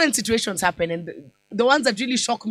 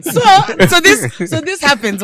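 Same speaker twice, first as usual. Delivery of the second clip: higher and louder, laughter fading; laughter fading back into the story; still laughing; 7 semitones higher, 10 dB louder, much slower than usual. Real, not acted. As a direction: pull yourself together mid laugh. Recovering from laughter.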